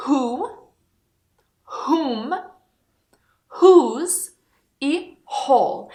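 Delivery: a light puff of h, no w. Shown as a demonstration. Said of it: "H deve ser pronunciada".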